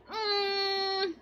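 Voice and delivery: slow, whiny, mock-babyish drunk voice; imitating a clingy drunk baby; drunk toddler whine. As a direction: whimper it like a sleepy drunk toddler. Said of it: "I'm just like".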